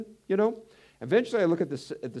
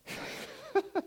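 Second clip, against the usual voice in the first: a quick high chuckle; brief high laugh; 4 dB quieter than usual. Real, not acted. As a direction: laugh lightly mid-sentence. Laugh before reading verse.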